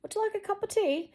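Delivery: put-on playful, high-pitched English accent. Southern speaker slips into English, playful high English accent. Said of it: "sometimes I get in, like, like, English".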